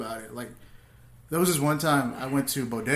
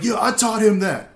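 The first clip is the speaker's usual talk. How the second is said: loud, higher-pitched angry boast; retold angry roast; 6 semitones higher, 6 dB louder than usual. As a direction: fire back at a heckler, retelling it. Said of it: "I just got angry".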